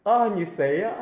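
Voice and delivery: a hint of befuddled old person; senile old devotee. Old devotee stares at the master, lightly voiced confusion. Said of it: "就像痴呆一样的".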